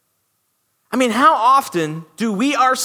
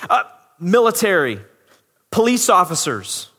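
normal talking voice

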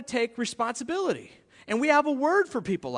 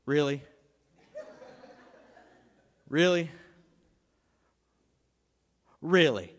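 skeptical mom; 6 semitones lower than usual. Retold or imitated. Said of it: "she would look at me and say".

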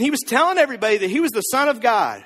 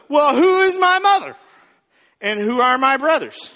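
lightly tipsy, louder retort as Jesus; drunk Jesus; 3 semitones higher, 4 dB louder than usual. Retold or imitated. Preacher voicing Jesus asking after his family, mock tipsy. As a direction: play Jesus as a touch drunk, opening big. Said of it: "It's almost like drunk Jesus".

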